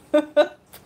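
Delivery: bright burst of laughter; loud laugh. Real, not acted. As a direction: laugh out at the irony. Laughs at own retort.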